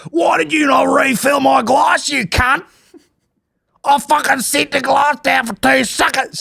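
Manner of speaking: high, slow, shouted put-on rage; mock furious yell. Podcaster imitating a drunk girl yelling over her wine, high shout. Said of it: "So violent".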